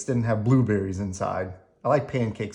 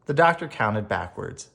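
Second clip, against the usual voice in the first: plain reading voice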